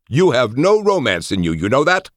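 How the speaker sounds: high, loud affronted protest; exasperated, high-pitched rebuke; indignant scolding. A narrator's reading. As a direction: scold your friend, pitch soaring. Indignant romantic scolds friend.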